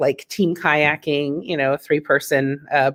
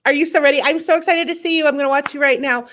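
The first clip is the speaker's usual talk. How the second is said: much higher, bubbly welcome; bright excited host; 9 semitones higher, faster than usual. Real, not acted. To the next singer, an excited high welcome. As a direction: cheer on the new friend.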